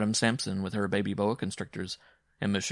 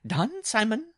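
loud, very high-pitched acceptance; bride crying out; 5 dB louder, slower than usual. A narrator's reading. Bride accepting Simon's wager, crying out high. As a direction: seize the bet in a high cry. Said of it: "the bride cried".

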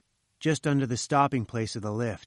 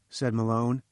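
plain reading voice

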